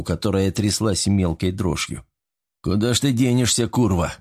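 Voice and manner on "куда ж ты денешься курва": plain reading voice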